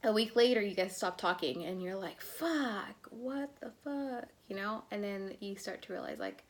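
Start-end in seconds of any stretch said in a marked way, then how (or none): 2.2-4.3 shocked disbelief. Retold or imitated. Shocked after texts stop.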